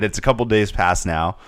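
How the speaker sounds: usual speaking voice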